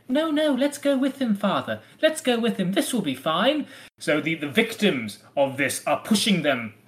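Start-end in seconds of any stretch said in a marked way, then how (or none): 0.1-3.7 high-pitched imitation of eager children; children's voices. Host voicing the children urging their father, high child voice. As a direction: pitch it up like kids coaxing a parent. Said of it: "his own children are saying".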